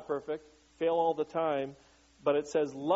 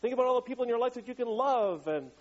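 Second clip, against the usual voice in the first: higher, faster hammed-up rally cheer; mock pep rally; 8 semitones higher than usual. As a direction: pump up the crowd, over the top. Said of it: "a little pep rally".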